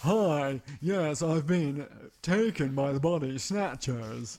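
slow, exaggerated old-time American accent; 1950s American accent. Mocking the film's American accent to his cohost. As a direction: drawl it out like a fifties movie American. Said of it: "an actual accent in America that talks like this".

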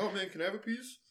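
high, quick put-on voice for Saul; squeaky mock Saul asking for cake; quoting Saul. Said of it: "he's like".

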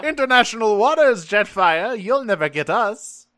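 high, loud, laughing taunt; taunting villain. Retold or imitated. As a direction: jeer at the hero from afar. Decepticon taunt voiced.

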